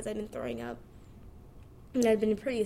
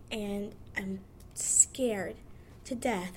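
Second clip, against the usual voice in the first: whispered confession, slow; 2 semitones lower, 3 dB quieter, much slower than usual. Real, not acted. Confessing fear of motherhood.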